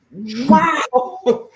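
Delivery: high, loud amazed wow. Retold or imitated. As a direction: act floored by the surprise. Amazed at sister's singing.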